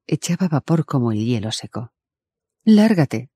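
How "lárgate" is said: high, loud snapped order; growled order. A narrator's reading. Narrator ordering the creature away, growling. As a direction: drive it off with a sharp angry bark. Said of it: "le dije con un gruñido".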